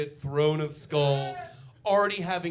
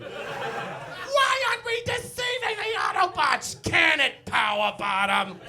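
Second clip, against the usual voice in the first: catty robot diva; 11 semitones higher, slower than usual. Acted. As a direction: bicker like a drag queen. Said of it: "screaming at each other like they're backstage at a drag show".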